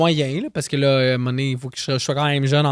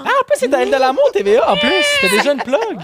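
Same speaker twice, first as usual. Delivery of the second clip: excited; 10 semitones higher, 5 dB louder than usual. Real, not acted. Excited about TV network contact.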